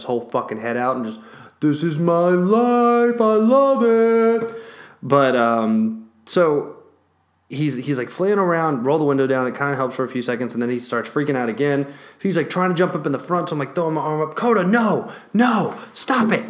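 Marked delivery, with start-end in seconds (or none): 1.6-4.5 slow, higher, dreamy dog voice; blissful dog. Retold or imitated. The dog with his head out the window, a slow blissful high dog voice. 14.3-16.4 higher, sharp call of no; scolding the dog. Retold or imitated. The owner to the dog jumping into the front, a higher scolding call.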